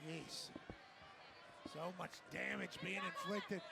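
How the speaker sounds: usual speaking voice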